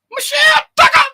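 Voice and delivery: very high, loud squeal of the name; excited shout of name. Real, not acted.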